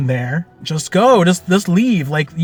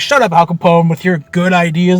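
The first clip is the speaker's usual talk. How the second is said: louder, exasperated mock put-down; mock scolding; 3 semitones higher, 7 dB louder than usual. Players silence the fake Al Capone, mock exasperation. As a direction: shut him up with playful irritation.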